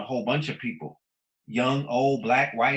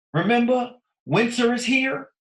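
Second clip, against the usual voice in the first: pitched way up and louder, rallying; excited high call; 9 semitones higher, 5 dB louder than usual. Real, not acted. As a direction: throw it up high to remind them. Rhetorical call to the audience.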